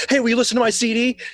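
quick, high mock fan pitch; pestering fan. Fan running up to a star with a CD, eager and high. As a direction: pipe up fast like a hopeful fan.

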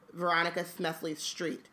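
ordinary talk

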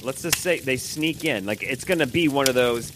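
ordinary talk, nothing marked

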